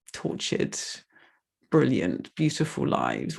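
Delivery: real tears, words breaking and whispered; barely holding it together, voice cracking; tearful. Real, not acted. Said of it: "sorry, I'm gonna get emotional".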